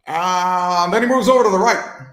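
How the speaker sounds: former president. Acted. Impressionist addressing Americans over there, former-president voice. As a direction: speak in measured bursts like a former president. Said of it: "a former president who kinda talks like this".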